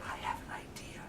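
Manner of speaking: conspiratorial whisper; sneaky whispered plan. Acted. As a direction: hush it to a fellow frog. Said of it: "He whispered to another frog".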